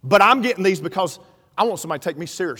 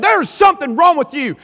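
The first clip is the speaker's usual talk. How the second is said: raised, higher-pitched retold scolding; stern accusation; 7 semitones higher, 6 dB louder than usual. Wife tells preacher something is amiss, acted stern accusation. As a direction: act out a wife's insistent accusation.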